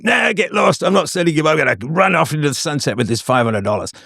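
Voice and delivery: high-pitched mock Cockney villain; playful Cockney crook voice; Cockney accent. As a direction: ham up a Cockney swindler running off. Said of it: "I suddenly have a Cockney accent".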